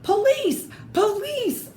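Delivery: high, loud yell for the police; shouting. Acted. Neighbour yelling for the police at the flying pumpkin, loud high shout. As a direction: cry out for help, alarmed. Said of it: "shouted Mister Klug".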